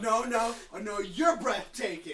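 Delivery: mock Keanu reply. Player mimics Keanu back.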